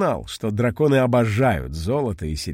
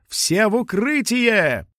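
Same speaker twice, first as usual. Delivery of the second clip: shouting; 10 semitones higher, slower than usual. Acted. Warning everyone to take cover.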